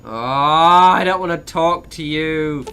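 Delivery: drawn-out loud reluctant whine; reluctant groan. Real, not acted. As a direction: drag it out like you can't be bothered. Avoiding a game character.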